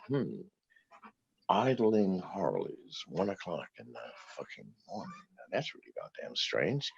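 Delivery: quiet, retold thinking aloud; hushed musing. Man alone at the window hearing motorcycles, quietly suspicious. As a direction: softly puzzle it out to yourself.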